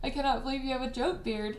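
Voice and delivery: usual speaking voice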